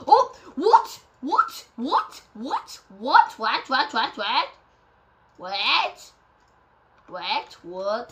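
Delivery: rapid-fire repeated questions, each jumping upward; gobsmacked. Real, not acted. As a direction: keep asking in total disbelief, rising each time.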